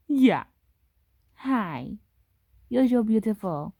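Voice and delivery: normal talking voice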